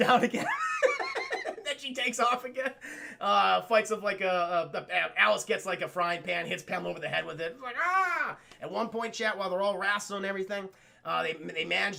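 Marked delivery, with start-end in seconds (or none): 0.0-0.5 loud, cracking up mid-sentence; laughing through the words; real laughter. Real, not acted. Cracking up at own retelling. 0.5-1.6 helpless high laugh; real giggling fit; high wheezing laugh. Real, not acted. Laughing at the scene. 7.8-8.4 high "ah" of impact; hammed-up cry of pain; mock pained yell. Pamela hit with frying pan.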